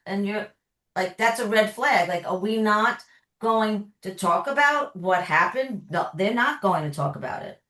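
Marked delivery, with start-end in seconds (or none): none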